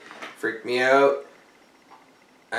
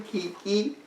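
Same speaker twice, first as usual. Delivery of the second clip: ordinary talk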